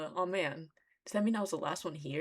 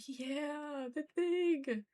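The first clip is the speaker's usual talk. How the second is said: high, drawn-out cheer; giddy high-pitched delight; delighted squeal; 11 semitones higher, much slower than usual. Real, not acted. Delighted at the title callback.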